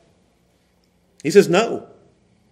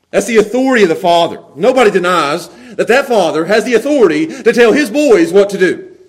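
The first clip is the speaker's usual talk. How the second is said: usual speaking voice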